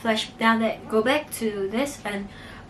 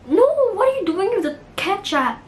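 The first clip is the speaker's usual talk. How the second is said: alarmed; 10 semitones higher, 5 dB louder than usual. Retold or imitated. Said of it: "Ellie was like".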